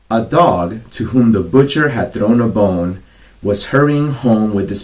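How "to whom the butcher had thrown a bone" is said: ordinary delivery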